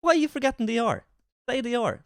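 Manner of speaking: high-pitched, exasperated mock telling-off; mock scolding. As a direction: playfully demand it in a high, indignant voice. Mock scolding the English teacher.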